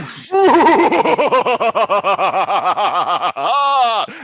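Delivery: long, loud evil cackle; high cackle swelling to a shout; villain's laugh. Acted. Villain reveals himself after grandma scene.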